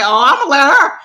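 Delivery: loud, high exclamation breaking into laughter; retold outburst, voice leaping up; gleeful stepping back. Employee letting the fighting manager take over, loudly amused. Retelling her reaction, laughing.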